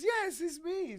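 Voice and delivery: high, loud pop star impression. Star confirming who is calling, high mimicked voice. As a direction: put on the star's high-pitched voice. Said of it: "he says".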